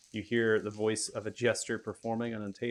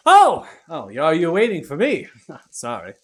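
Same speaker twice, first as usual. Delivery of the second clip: much higher, louder startled cry; startled yelp; 7 semitones higher, 15 dB louder than usual. Acted. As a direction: jump with surprise, high and loud. Towel-clad person startled at door.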